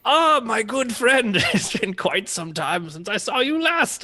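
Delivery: put-on high, slow, effusive old-friend act; charmer's greeting. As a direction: draw out an oily, overdone welcome.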